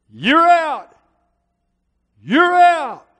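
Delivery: high, loud umpire shout; umpire screaming. Retold or imitated. Umpire yelling the call at the crowd, loud shout. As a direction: bellow the call like an outraged umpire.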